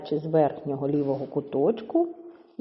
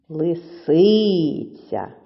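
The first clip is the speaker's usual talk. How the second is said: "Лисиця" with СИ stretched very long; stress on second syllable; much slower than usual. Shown as a demonstration. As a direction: slowly sing out the СИ in "Лисиця". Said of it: "Другий склад наголошений". («Лисиця» з протяжним наголосом на СИ)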